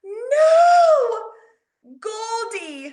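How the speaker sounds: very high loud anguished scream; little sister screaming over dead goldfish. Retold or imitated. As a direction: wail as if the pet just died. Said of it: "sister scream out".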